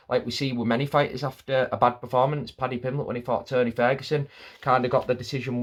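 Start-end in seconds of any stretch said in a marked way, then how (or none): none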